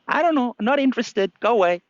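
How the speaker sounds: put-on high, dismissive old monkey; lightly voiced grumpy old monkey. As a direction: wave him off as the old monkey.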